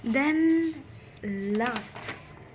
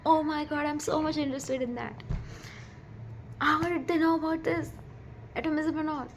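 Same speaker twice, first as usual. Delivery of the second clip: excited; 6 semitones higher than usual. Real, not acted. Gushing about atomism in scriptures.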